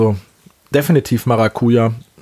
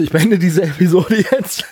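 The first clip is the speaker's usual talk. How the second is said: raised voice, joking exit line; mock dramatic; 6 semitones higher than usual. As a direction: pretend to end the show in delight. Joke after tasting beer.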